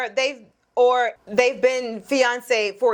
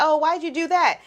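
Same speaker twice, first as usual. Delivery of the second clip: mother-in-law imitation; 6 semitones higher than usual. Host imitating the boyfriend's mother on speakerphone, lightly mock questioning. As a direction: lightly voice a meddling mom's question. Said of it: "She's like".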